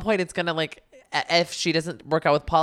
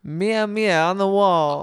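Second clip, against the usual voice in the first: slow, higher, badly faked Jersey accent; 4 semitones higher, 4 dB louder, slower than usual. Co-host retrying after being corrected, badly faked Jersey accent. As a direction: have another drawn-out go at mock Jersey. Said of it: "This is so bad".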